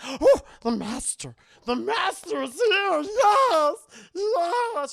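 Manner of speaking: high, loud, whimpering creature cry; Gollum voice. Retold or imitated. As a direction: wail with joy like Gollum. Gollum Girl greets master.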